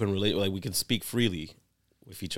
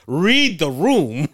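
slow, loud, raised-pitch plea; exasperated dad; 8 semitones higher, 9 dB louder, much slower than usual. Retold or imitated. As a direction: drag out each word in comic exasperation. Exasperated dad retelling advice to son.

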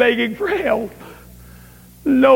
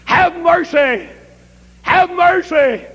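crying out; 6 semitones higher, slower than usual. Retold or imitated. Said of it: "she would cry out".

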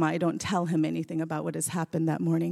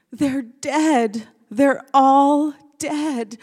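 slow, loud, higher crying wail; retold bawling; 7 semitones higher, 8 dB louder, much slower than usual. Caius telling mom the mice died, sobbing. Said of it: "Caius is just bawling".

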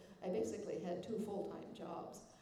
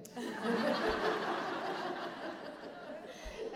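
high laugh; 11 semitones higher, 7 dB louder than usual. Real, not acted. Laughs at husband's joke.

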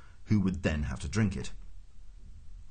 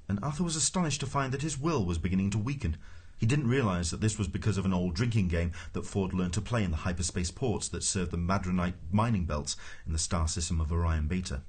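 ordinary delivery, nothing marked

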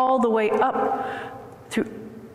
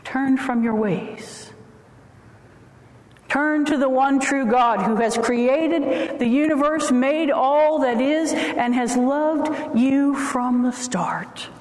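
preaching at full force; 4 semitones higher, slower than usual. Acted. Rising sermon climax.

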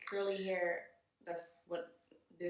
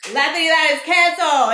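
much louder, higher announcement; all-caps read; 10 semitones higher, 18 dB louder than usual. Retold or imitated. Quoting the red all-caps post to her guest, loud and high.

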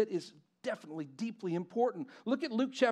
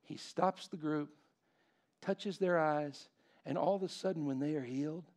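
soft, lower, reverent preaching; hushed preaching; 7 semitones lower, 8 dB quieter than usual. Acted. Pastor to congregation on Bartimaeus, hushed and reverent. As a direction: draw them in with a near hush.

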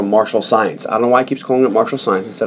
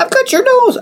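very high, squeaky teasing voice; playful mock threat. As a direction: tease in a silly falsetto. Repeats the silly nose threat.

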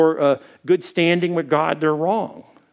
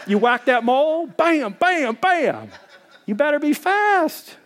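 high, punchy bams; whack-a-mole; 9 semitones higher than usual. Pretend. Telling the church to whack moles fast, high and punchy. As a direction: bang each mole as it pops up.

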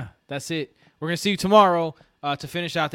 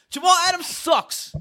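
a much higher, heated jab at the player; angry rant; 13 semitones higher than usual. Real, not acted. Fan tells his cohosts the player sucks, pitched high and heated. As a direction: spit the name out in disgust.

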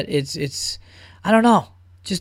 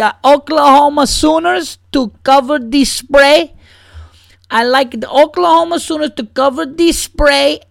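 put-on high, slow Spanish-accented gambler; 8 semitones higher, 3 dB louder, slower than usual. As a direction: play a Spanish gambler placing a bet, heavy accent. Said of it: "I was doing an impression of a Spanish guy".

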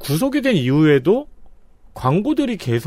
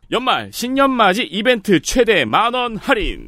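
raised, upbeat sales voice; infomercial; 7 semitones higher than usual. Acted. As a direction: pitch the discount like an ad. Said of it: "저는 이제 톤으로 알려드리는 거죠".

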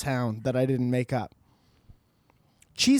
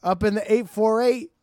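hype shout-out; 6 semitones higher, 5 dB louder than usual. Acted.

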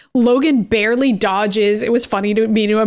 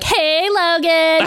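mock Fury greeting; 7 semitones higher, much slower than usual.

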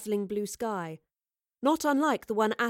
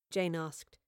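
usual speaking voice

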